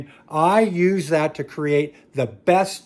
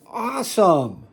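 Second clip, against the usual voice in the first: mock cheer; 6 semitones higher, 3 dB louder, slower than usual. Sarcastic aside after a point.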